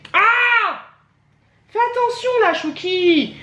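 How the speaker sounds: haut et fort, prévient l'enfant; cri soudain pour arrêter le bébé; cri d'avertissement. Real, not acted. Gronde le bébé qui renverse. (cri au bébé qui jette tout)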